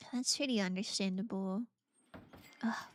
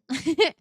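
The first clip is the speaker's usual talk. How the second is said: quick bright laugh, much louder; burst of laughter; 4 semitones higher, 10 dB louder than usual. Real, not acted. Laughing at what the game lets her give. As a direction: laugh out at the odd gift.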